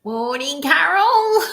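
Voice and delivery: chirpy British gent sweeping up on the name; Mister British impression. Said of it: "it really sounded like Mister British".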